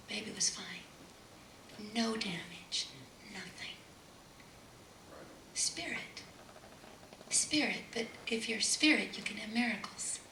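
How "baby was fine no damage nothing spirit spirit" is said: soft, almost whispered; hushed. Real, not acted. Preacher revealing the miracle, hushed whisper. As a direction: let it drop to a whisper.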